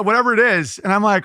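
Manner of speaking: ordinary delivery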